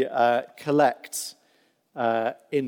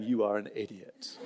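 laughing, dropped low; 4 semitones lower, 8 dB quieter than usual. Retold or imitated. Fisherman's unspoken verdict, laughing.

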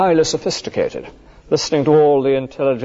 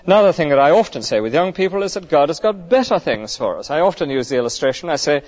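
usual speaking voice

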